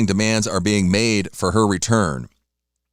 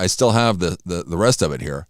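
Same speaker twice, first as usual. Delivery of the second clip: usual speaking voice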